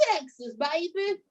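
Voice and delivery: put-on light Texas accent on Texas, baby; hamming up a Texas accent. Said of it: "did I do that accent right?".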